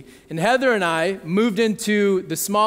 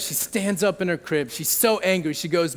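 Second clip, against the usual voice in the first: usual speaking voice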